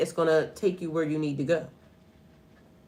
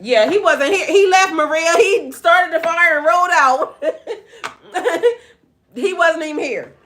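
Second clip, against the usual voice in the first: laughing; 7 semitones higher, 4 dB louder than usual. Real, not acted. Laughing while telling Maria about son.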